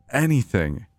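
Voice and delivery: ordinary delivery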